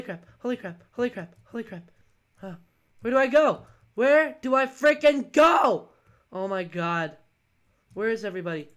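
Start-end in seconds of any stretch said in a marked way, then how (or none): 3.0-5.9 loud panicked outburst; frantic yell. Real, not acted. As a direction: call out desperately for directions. Lost and panicking in game.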